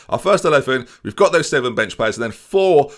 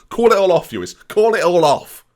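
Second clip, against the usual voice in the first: loud, higher-pitched verdict, slowing on the repeat; emphatic declaration; 5 semitones higher, 4 dB louder than usual. Real, not acted. Manager telling viewers what follows a loss, loud and emphatic. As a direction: declare it loudly and drag out the last time. Mock drastic verdict on losing.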